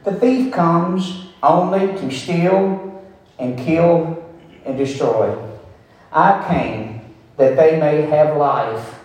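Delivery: measured pulpit reading of Jesus' words; scripture reading. Acted. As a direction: read the verses as holy words, unhurried. Reads scripture passage aloud.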